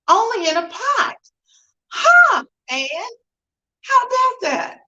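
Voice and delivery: high amazed disbelief; surprised. Real, not acted. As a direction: sound truly astonished. Amazed at scrambling in a pot.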